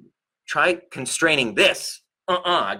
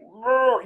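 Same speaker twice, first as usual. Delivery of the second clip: mock roar; 11 semitones higher than usual. Mother's roar at the baby.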